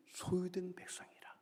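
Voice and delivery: slow soft whisper. Real, not acted. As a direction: breathe the verse slowly. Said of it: "whispered scripture".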